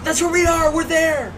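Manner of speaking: loud, very high yell of recognition; excited shout. Real, not acted. Gamer spots where the crew is on the boat, excited shout.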